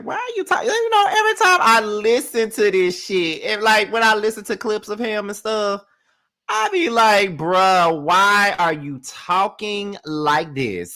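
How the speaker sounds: very high-pitched, hammed-up bafflement; overdone high-voiced exasperation; exasperated complaint. Exaggerated complaint about Andy's podcast voice.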